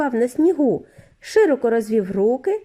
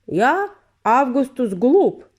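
put-on slow, slightly louder boy's boast; Augustus; 3 semitones higher, 4 dB louder, slower than usual. A narrator's reading. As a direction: announce yourself smugly, slow. Augustus introduces himself. (повільно, самовдоволено)

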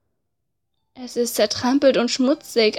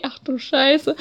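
ordinary talk

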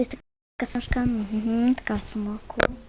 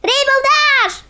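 slow high call of the name; 10 semitones higher, 3 dB louder, slower than usual. Acted. Friend calling Rainbow Dash at her door, loud slow call.

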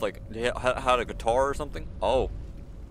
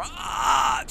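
a short loud high cry of surprise; startled shout; 10 semitones higher, 4 dB louder than usual. Real, not acted. Player hit by a flying rock, yelps. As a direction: yelp as the rock hits.

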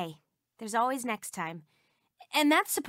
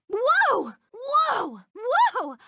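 high loud startled whoa; 9 semitones higher, 6 dB louder, much slower than usual. Acted. Game character jolted by a sudden hit, a loud startled whoa. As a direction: blurt out the shock.

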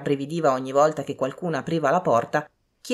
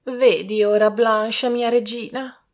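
higher-voiced remonstrating lady; mother; 7 semitones higher, 4 dB louder, slower than usual. A narrator's reading. Lady remonstrating with her daughter, high mother voice. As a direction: plead with her in a raised pitch.

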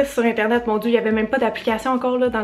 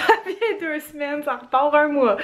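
laughing repeat; 4 semitones higher than usual. Real, not acted. Repeats her habit, laughing.

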